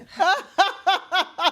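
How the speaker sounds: high, loud laugh at his own punchline; big laugh. Real, not acted. Guest laughing at his own story of the king's prank, high loud laugh. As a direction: crack up at the story's twist.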